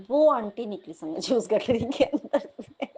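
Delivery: words breaking up into laughter; laughing. Real, not acted. Neighbour aunty popping out of the bundle, laughing. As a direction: tell it while giving in to real laughter. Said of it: "मुझे हँसी भी आ रही है".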